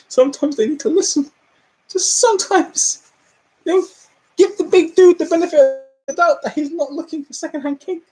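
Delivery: high, pleading and exasperated; begging people to listen. Real, not acted. Exasperated plea, raised voice.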